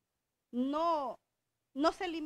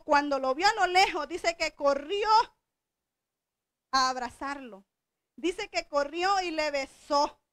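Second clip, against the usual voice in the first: loud, fervent preacher building up; impassioned sermon climax; preaching at full force; 3 semitones higher, 7 dB louder than usual. Acted. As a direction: preach it out with rising fire. Preacher climaxing on prodigal son.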